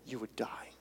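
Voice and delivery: unvoiced hush; whispered warning. God warning Moses about his glory, whispered. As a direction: breathe it as a warning.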